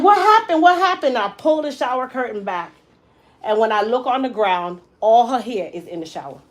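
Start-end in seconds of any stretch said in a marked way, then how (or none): 0.0-1.2 retold panic, pitched well up and louder; panicked asking. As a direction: call out to her in alarm, twice. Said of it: "And I'm like".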